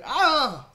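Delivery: loud, high acted yelp; sudden comic "ah!"; yelp of pain. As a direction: cry out as if bonked on the head. Gnome is bonked on the head.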